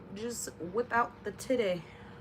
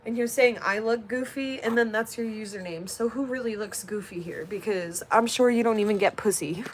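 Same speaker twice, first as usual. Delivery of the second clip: annoyed; 7 dB louder than usual. Real, not acted. Ranting at a commenter on stream.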